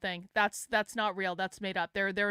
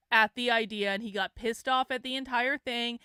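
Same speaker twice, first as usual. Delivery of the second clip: usual speaking voice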